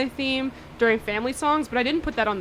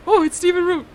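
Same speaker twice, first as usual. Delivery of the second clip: excited exclamation; 7 semitones higher, 6 dB louder than usual. Retold or imitated.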